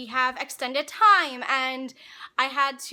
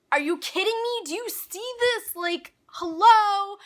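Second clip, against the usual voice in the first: can't believe what she's hearing; exasperated disbelief; 7 semitones higher, 4 dB louder than usual. Retold or imitated. Exasperated retort to the professor.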